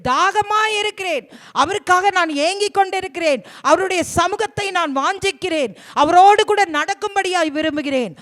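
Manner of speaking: fervent preaching. Real, not acted. Preacher voices David's thirst for God, fervent. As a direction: preach it with rising fervor.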